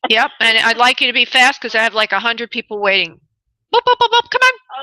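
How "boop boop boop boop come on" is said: very high, loud beeping prod; playful hurrying. Real, not acted. Host rushing caller with many waiting, playful hurrying. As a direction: chivvy them along in a squeaky high pitch.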